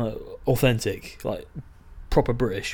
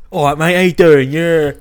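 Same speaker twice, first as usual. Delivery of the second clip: put-on loud, higher-pitched London-area accent; mock local accent; 4 semitones higher, 11 dB louder than usual. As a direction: ham up a southern English mate's accent. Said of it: "Ours ain't the best".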